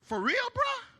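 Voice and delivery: high, disbelieving cry; high surprised comeback to the dismissive friend; incredulous. Retold or imitated. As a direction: sound stunned by his answer. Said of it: "I was like".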